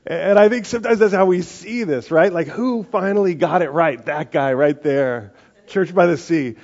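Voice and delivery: rising, forceful sermon peak; preaching at full force. Real, not acted. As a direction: preach it big to the room.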